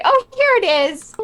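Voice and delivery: high, loud, sing-out presentation; Fiona. Acted. Player voices Fiona showing picture.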